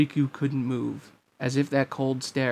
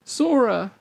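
high, louder call of alarm; alarmed cry; 10 semitones higher, 5 dB louder than usual. A narrator's reading. Girl crying out to her knocked-back friend, alarmed. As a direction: cry his name out high. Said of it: "cried Namine".

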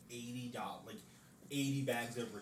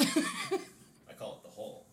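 laughing; 12 dB louder, slower than usual. Real, not acted. Jokey nickname for Whole Foods.

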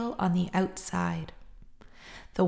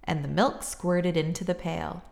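usual speaking voice

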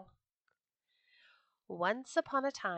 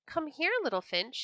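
higher call of the great ruler bird; 7 semitones higher than usual. A narrator's reading. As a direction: call the little finch over. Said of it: "he cried".

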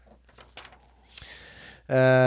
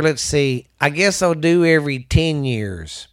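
normal talking voice

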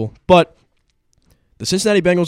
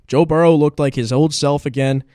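ordinary talk, nothing marked

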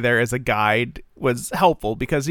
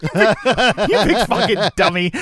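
laughing through a mock insult, pitched high; 7 semitones higher, 3 dB louder than usual. Real, not acted. Critic laughing at the hero's cheesy line, high laughing jeer. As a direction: crack up while calling him a dummy.

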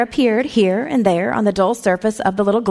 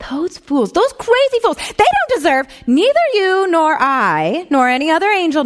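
angry outburst; 8 semitones higher than usual. A narrator's reading. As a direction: rage at the fools. Senior angel's outburst at fools.

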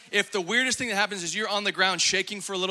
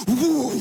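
mock whoop; 3 semitones higher, 6 dB louder than usual. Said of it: "then he's like".

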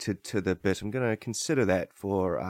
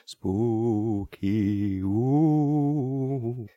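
ghost moan; much slower than usual. Acted. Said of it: "ghost cast".

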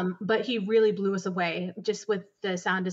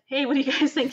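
eager composer; 6 semitones higher, 4 dB louder than usual. Retold or imitated. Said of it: "he's like".

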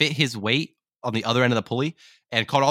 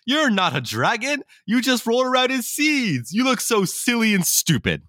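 much higher, sneering taunt in a put-on voice; mocking dogs; 12 semitones higher than usual. Other dogs taunting the would-be dragon, high mocking sneer. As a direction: jeer at him like a schoolyard bully, high and nasty.

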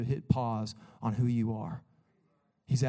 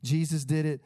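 usual speaking voice

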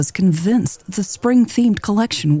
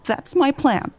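put-on a higher old woman's voice after sniffling; tearful; 6 semitones higher than usual. A narrator's reading. Grieving mother sharing her plan, tearful and higher. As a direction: push through the tears with resolve. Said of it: "The woman sniffled and continued".

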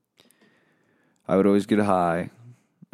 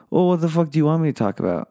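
louder, higher, fast complaint; exasperated outburst; 7 semitones higher, 8 dB louder, faster than usual. Acted. Host to listeners about airtime, exasperated and fast. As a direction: snap it out at them.

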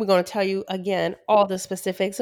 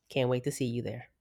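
ordinary delivery

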